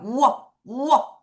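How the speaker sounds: "워" repeated as separate syllables; W sound drill. Shown as a demonstration. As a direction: isolate the rounded glide in "워". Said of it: "다시 한번 갈게요".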